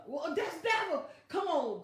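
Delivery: ordinary talk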